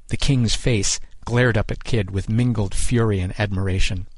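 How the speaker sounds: ordinary delivery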